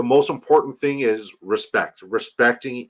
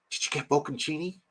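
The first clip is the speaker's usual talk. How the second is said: soft, higher-pitched question, rising at the end; playful hushed repeat; 4 semitones higher, 9 dB quieter than usual. Real, not acted. Streamer quizzing a friend about his sandwich, hushed.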